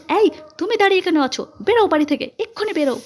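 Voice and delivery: high, forceful command; angry order. A narrator's reading. Mother throwing girl out of the house, angry command.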